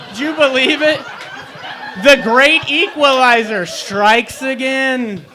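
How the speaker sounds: loud, high, slow announcement; dramatic proclamation. Acted. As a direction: boom it out like a movie trailer. Story climax, toe stubbed again.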